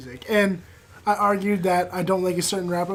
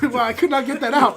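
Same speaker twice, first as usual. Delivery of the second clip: usual speaking voice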